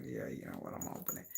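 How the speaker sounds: very soft, low aside to oneself; barely voiced retold decision; retold quiet resolve. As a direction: drop to a near-whisper, giving in. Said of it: "And I was like".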